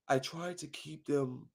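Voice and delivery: slow, hushed mock confession; somber, dead-sounding. Mocking a caught killer confessing on camera, somber and dead-sounding. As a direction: murmur it low and lifeless. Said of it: "talk as somber and dead sounding as you can".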